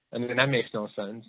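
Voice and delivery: usual speaking voice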